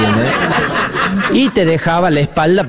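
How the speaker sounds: ordinary delivery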